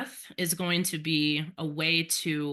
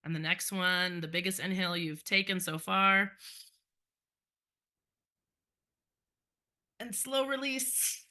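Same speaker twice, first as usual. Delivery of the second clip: guided breathing. Acted. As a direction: lead the class gently through breaths.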